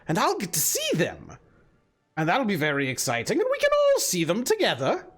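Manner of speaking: coaxing hag with swooping pitch; hag character. Acted. Hag proposes a memory spell to Lulu, coaxing hag voice. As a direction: sell the plan in the lilting hag voice.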